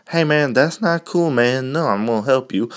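indignant friend. Acted.

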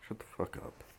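breathy whispered shut-up to himself; whispered aside. Real, not acted. Muttering to himself about people, whispered. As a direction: drop to a whisper, annoyed.

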